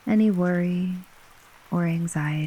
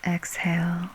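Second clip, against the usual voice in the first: whispering. Acted. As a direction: whisper it gently to a sleeper. Breathing cue.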